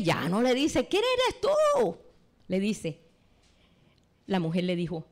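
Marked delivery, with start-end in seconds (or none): 0.9-2.0 very high, slow, stretched exclamation; exclaiming. Shown as a demonstration. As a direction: throw the question up high in protest. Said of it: "Ya no le habla exclamándole".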